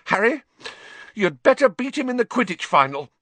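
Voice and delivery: put-on a wound-up girl insisting; shrill. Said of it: "Hermione said shrilly".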